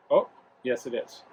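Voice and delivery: normal talking voice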